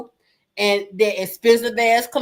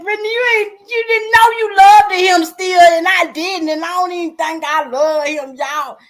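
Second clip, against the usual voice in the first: worked-up scolding; 8 semitones higher, 4 dB louder than usual. Retold or imitated. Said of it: "I said".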